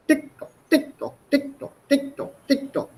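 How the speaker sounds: high, soft tick-tock like a clock; teacher imitating a clock ticking; ticking clock sound. Shown as a demonstration. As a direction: tap out the clock's beat. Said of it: "That's the sound that you get here".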